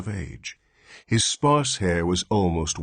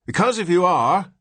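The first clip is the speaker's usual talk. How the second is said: put-on high, louder old man's complaint; querulous; 12 semitones higher, 7 dB louder than usual. Old newborn to his father, querulous old voice. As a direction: grumble like a cranky old man. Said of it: "went on the old man querulously".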